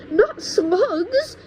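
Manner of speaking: high, loud horrified cry; cried out. A narrator's reading. George horrified by eating slugs, crying out loud. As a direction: cry out in disgust. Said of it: "George cried out".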